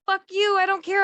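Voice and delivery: high, defiant brush-off; hammed-up petulant defiance; mock defiant crowd. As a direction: sneer it like a selfish beachgoer. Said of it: "people are saying".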